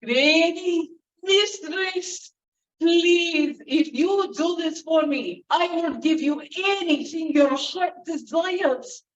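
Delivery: high, slow, earnest begging; pleading Baron. Acted. Baron begging the old woman for his chest. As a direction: implore her eagerly.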